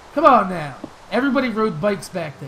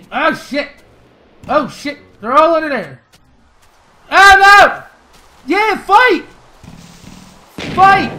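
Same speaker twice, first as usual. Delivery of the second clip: yelling in panic as the monsters swarm him; panicked yelling; 8 semitones higher, 12 dB louder than usual. Real, not acted. Gamer ambushed by monsters at night, panicked shouting. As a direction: shout in real alarm, much higher and louder.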